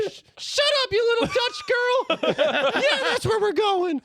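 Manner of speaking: tiny, high cookie voice; little voice. Acted. Gingerbread man popping out of the shadows, tiny high voice. Gingy snapping at Stan.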